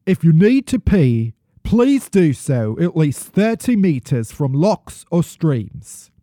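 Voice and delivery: put-on hammy British accent, slipping as it goes. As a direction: read it out in a shaky attempt at British. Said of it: "I'm really losing this accent".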